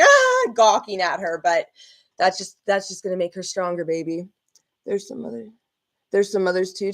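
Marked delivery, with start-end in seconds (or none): 0.0-0.5 high, loud "ah" of shock; mock gasp. Imitating onlookers gawking at her, mock shocked cry. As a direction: act out an aghast gawker's cry. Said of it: "kind of like".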